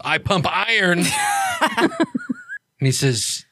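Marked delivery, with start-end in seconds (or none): 0.1-1.0 a mild mock of the troll's line; mocking repeat. Friend mocking the troll's boast. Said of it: "looks over at him and goes". 1.1-2.6 a high burst of laughter. Real, not acted. GM laughing at his own troll joke. Laughter after mocking line.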